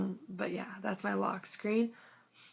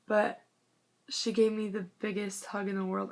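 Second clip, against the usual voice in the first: ordinary delivery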